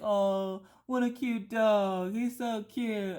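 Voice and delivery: high, slow sweet coo; mock-sweet bystander cooing; cooing onlookers. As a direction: coo over the dog like a bystander. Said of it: "And everybody's like".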